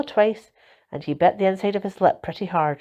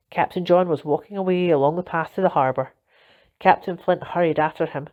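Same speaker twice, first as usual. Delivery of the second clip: ordinary delivery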